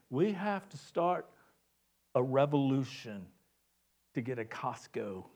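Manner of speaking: imitated Frenchman, fading low to a whisper; French accent. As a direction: declare it as the Frenchman, sinking to hushed. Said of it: "the French accent".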